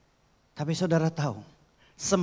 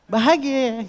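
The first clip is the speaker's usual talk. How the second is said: mock cheerful; 9 semitones higher, 7 dB louder, slower than usual. Mock happy exclamation.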